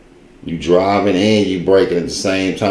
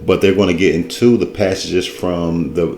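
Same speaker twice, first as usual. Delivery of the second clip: usual speaking voice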